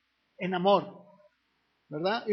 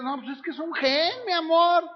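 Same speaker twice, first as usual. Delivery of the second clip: mock sweet-talking husband; 6 semitones higher than usual. Unfaithful husband sweet-talking his wife, mock coaxing. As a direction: play the cheating husband wheedling his wife. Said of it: "¿Qué le van a decir a su mujer?".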